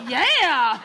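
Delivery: excited cheering. Pretend. Cheering dog on.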